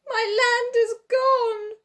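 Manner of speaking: high, loud, slow wail of alarm; a man finding his home gone, shrieking. A narrator's reading. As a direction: shriek it out in horror. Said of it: "shrieked the Saucepan Man".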